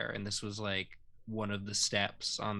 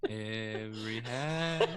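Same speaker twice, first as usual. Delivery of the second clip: slow; slower than usual. Pretend. Slow repeat of the joke.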